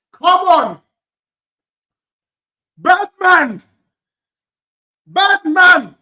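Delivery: loud, high punched exclamations; celebratory shout. Real, not acted. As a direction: shout it out in triumph at the match. Celebrating during live football watchalong.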